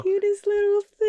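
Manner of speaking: high-pitched gushing coo. Real, not acted.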